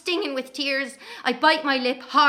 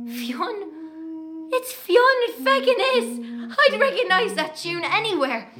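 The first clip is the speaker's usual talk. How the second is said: much higher and louder, the second line slow, bursting with joy; excited recognition; 7 semitones higher, 5 dB louder, slower than usual. Acted. Hearing Fionn's tune in the dark street, a high thrilled cry. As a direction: cry it out like a long-lost friend just came back.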